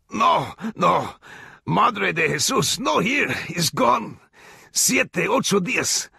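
very high, slow, strangled begging; hanged alcalde begging in Spanish; desperate plea. A narrator's reading. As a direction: plead for your life with no air. Hanged man pleading.

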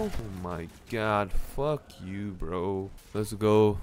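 usual speaking voice

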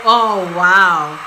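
ordinary talk

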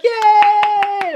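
high, loud imitated kids' cheer; mock cheer. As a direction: cheer like a whole class of kids. Retold cheering of the class.

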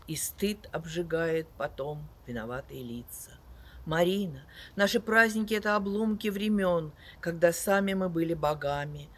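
loud, sweeping poetry recital with big swells; dramatic poetry declamation. Acted. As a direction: declaim each line like on stage. Reads poems aloud to audience.

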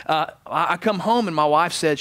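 ordinary talk

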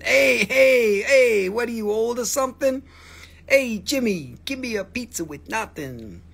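loud, high Jersey pizza-counter voice; mock Jersey pizza guy. Seller acting a Jersey pizza counter, loud and high.

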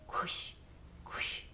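soft whispered crunching of footsteps on snow. Retold or imitated. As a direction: breathe out the crunch of boots in the snow. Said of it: "de cuando vas pisando en la nieve". (crujido de nieve susurrado, suave)

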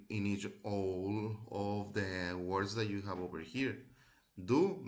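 usual speaking voice

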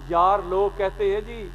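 ordinary delivery

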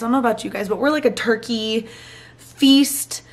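usual speaking voice

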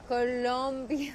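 Colombia slowly, Co-LOM-bia with a clear O; slow Spanish Colombia. Shown as a demonstration. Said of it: "With an O".

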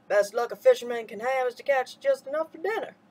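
put-on Elder Abbajohn teasing the boy kindly; old man's voice. A narrator's reading. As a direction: speak as a wise old man teasing. Papa Daddy voiced.

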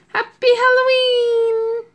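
high, drawn-out holiday shout; festive cheer. Real, not acted. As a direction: sing out the greeting, stretched long. Reader cheers at story's end.

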